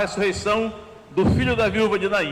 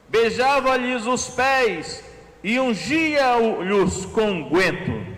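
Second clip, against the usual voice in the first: slow, raised reading of the verse; scripture reading; 5 semitones higher, slower than usual. Acted. Preacher reading the sinful woman's story, slow and raised. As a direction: linger over each act of the weeping woman. Reads Luke 7:38 aloud.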